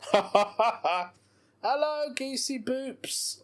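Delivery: high, slow cooing at a goose; silly sing-song greeting. Pretend. Greeting a goose in a silly cooing voice. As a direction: coo it like you're greeting a pet.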